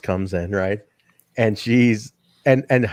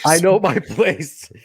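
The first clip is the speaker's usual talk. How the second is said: laughing echo; 6 semitones higher, slower than usual. Retold or imitated. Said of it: "she's like".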